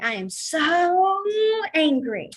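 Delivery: big drawn-out angry outburst, pitch lifted on SO; hammed-up anger. Shown as a demonstration. Teacher showing students how angry looks, hammed-up anger. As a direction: stretch it out, mock-furious. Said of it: "you are just smacking it down".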